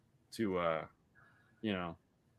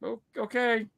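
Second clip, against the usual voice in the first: high-pitched, taken-aback agreement; startled compliance; 12 semitones higher than usual. Retold or imitated. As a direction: give in with a comic squeak. Giving in to the child.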